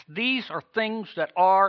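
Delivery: usual speaking voice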